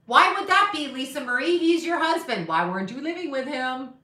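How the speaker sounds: high, loud accusing questions; mock grilling. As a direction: press her like a nosy interviewer. Mock-grilling Lisa Marie as interviewer.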